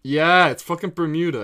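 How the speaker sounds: loud, high exasperated outburst; exasperated shout. Real, not acted. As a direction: yell it in triumphant disbelief. Realizes her guess was right.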